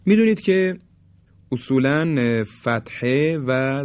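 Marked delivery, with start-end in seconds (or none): none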